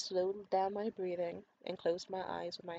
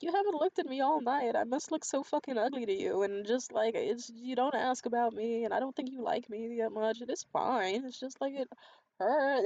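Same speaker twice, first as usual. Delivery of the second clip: re-enacted tearful outburst, raised pitch; breaking down; 5 semitones higher, 4 dB louder than usual. Woman confronting her date about not looking at her, acted breakdown. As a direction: let hurt spill out in a higher retold voice. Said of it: "And I just broke down".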